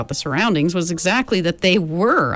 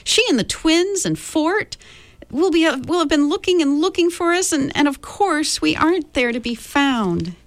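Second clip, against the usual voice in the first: high, worried rush of questions; fretting about her mother at home; anxious girl; 9 semitones higher than usual. A narrator's reading. Meg anxiously asks about her mother, high and worried.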